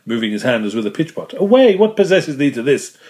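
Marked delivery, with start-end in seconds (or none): 1.4-3.0 angry outburst. A narrator's reading. Mate angered by carpenter's questions.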